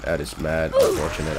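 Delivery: usual speaking voice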